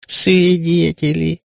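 high, drawn-out mock surprise; деланное удивление. A narrator's reading. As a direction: feign astonishment, stretching the word. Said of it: "деланно удивилась Кутияна". (с деланным удивлением, нараспев)

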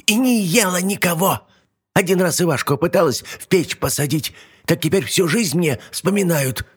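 put-on high indignant old hag; old witch protesting. Yaga protests.

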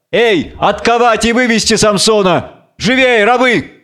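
high, urgent order to slaves; commanding call. Acted. Master orders slaves to free Samson.

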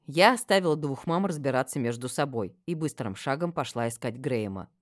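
ordinary delivery

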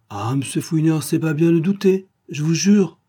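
put-on high, loud protesting kid; boy exclaiming. A narrator's reading. Gamin swearing to Fouinard he told the truth, exclaiming. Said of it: "le gamin s'exclama".

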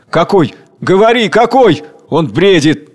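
high, eager crowd outcry; urgent demand. Acted. Crowd questions the second worker, urgent and loud. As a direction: press him sharply for the answer.

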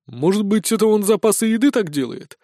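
high-voiced amused guess; smirking. A narrator's reading. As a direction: float a joke with a smirk. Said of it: "усмехнулся Сисюля". (с усмешкой)

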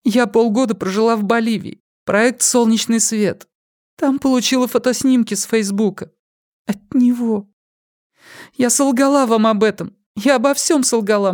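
high, tearful confession; after crying. A narrator's reading.

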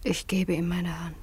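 low, unvoiced, defeated; resigned whisper. Daughter submits to the forced engagement, a resigned whisper. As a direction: give in with barely a voice.

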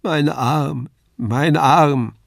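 whimpering, pitiful cry of pain; plaintive wail. A narrator's reading. Kasperl laments his broken arm, plaintive. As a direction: moan it like a broken puppet. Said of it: "mit kläglicher Stimme".

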